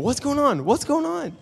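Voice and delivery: high, loud, laughing imitation of mom; a hammed-up mom cutting in at dinner; mock alarmed mom. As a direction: squeal it like a startled mother. Mom voiced across the table.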